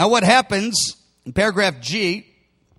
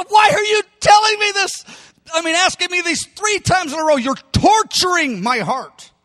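anguished, high-pitched outcry; grieved protest; 9 semitones higher than usual. Acted. Peter grieved by the third question, anguished high protest. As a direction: plead in pain, voice pitched way up. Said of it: "He was grieved".

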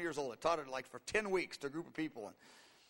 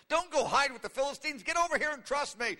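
higher, louder, acted-out urging; urgent pleading command; 7 semitones higher, 4 dB louder than usual. God urging David not to hide with the Philistines, urgent pleading. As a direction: call him back insistently.

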